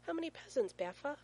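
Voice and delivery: put-on high, loud little-boy voice; small child's question. A narrator's reading. Small son questions dad about presents, eager and childlike. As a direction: ask high, like an eager toddler.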